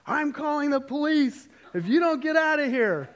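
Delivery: very high, loud shouted warning; assertive yell. Retold or imitated. Dad warns off whoever is in the woods, assertive retold yell. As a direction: shout a threat out into the distance. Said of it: "you know, assertive".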